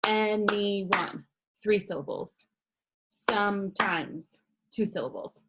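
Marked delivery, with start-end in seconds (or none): 0.1-1.2 "anyone" in three separate beats, an-y-one; split into syllables. Shown as a demonstration. Teacher counts syllables for class, "anyone" broken in three. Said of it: "one of them is clapping". 3.1-4.4 "Sometimes" slowly in two separate beats; split into syllables. Shown as a demonstration. Teacher shows clapping method, "Sometimes" split in two. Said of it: "one of them is clapping".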